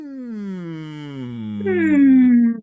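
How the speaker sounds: loud, high hum sliding down. Shown as a demonstration. Coach leads student to resonance center, high descending hum. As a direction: start high and hum downward. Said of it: "let's start up here".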